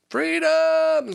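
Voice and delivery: long, high, loud battle-cry holler; rallying shout. Pretend. Host declaring podcast is separate from work, rallying shout. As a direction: holler it out, stretching the word.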